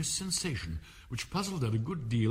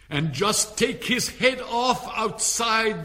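much higher, louder, slow command; Queen's order; 11 semitones higher, 8 dB louder, slower than usual. Acted. Queen orders an officer to behead the Hatter, high and loud. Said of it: "the Queen added".

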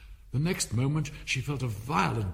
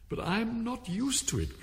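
high, drawn-out pleading; piteous; 12 semitones higher than usual. A narrator's reading. Alice pleading with the caterpillar, high and piteous. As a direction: plead plaintively, pitched well up. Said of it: "pleaded poor Alice in a piteous tone".

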